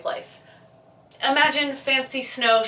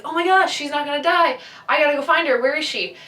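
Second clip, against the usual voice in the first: higher, louder, rushed excitement; excited boyfriend; 6 semitones higher, 4 dB louder than usual. Retold or imitated. Boyfriend hears she won't die, louder excited relief. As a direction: burst out with relief. Boyfriend learns she'll live.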